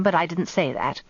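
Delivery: usual speaking voice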